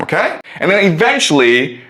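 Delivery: ordinary talk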